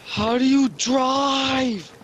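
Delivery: very slow, stretched-out question; panicked wail. Acted. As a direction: drag out every word in hammed-up panic. Panicking at the wheel.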